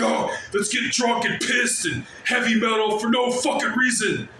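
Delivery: hammed-up party bro; mock dumb bro shout. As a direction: yell it like a drunk metalhead bro. Mocking big dudes' attitude.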